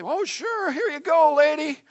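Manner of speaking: high, breezy mock obliging; mock easy agreement. Preacher imagining Jesus readily granting her plea, mock agreeable. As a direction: hand it over in an exaggeratedly agreeable voice.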